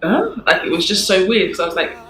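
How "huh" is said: sharp high startled huh; high, loud retold double take; surprised. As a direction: jolt up in pitch, caught off guard. Reacting to a surprise gift.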